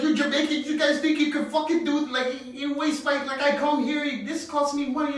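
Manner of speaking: high-pitched ranting cabbie impression; retold sudden rage. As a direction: rant indignantly as the imitated driver, pitch way up. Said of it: "the zero to sixty was wild".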